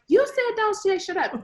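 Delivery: high-pitched, imitated accusation; child correcting mom. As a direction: call mom out like an indignant kid. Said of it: "And then they're like".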